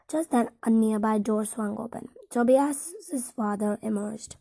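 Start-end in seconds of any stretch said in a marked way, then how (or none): none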